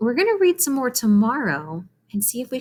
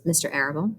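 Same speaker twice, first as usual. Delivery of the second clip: ordinary delivery, nothing marked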